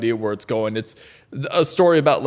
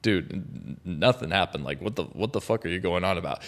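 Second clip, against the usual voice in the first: low, much softer, scoffing shopkeeper; dismissive shopkeeper; 8 semitones lower, 10 dB quieter than usual. Retold or imitated. Shopkeeper scoffing at the frightened customer, low and soft. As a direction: mutter it off as nonsense. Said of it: "He's like".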